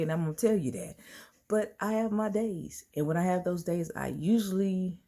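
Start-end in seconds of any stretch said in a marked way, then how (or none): none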